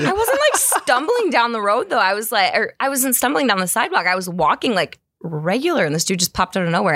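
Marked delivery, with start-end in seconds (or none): 0.0-2.0 indignant. Retold or imitated. Defending herself, high pitched.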